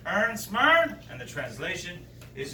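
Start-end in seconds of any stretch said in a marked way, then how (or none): 0.1-1.0 high, loud Newfoundland grunt; Newfoundland greeting; Newfoundland captain. Acted. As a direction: call it across the water like a captain. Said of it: "he says".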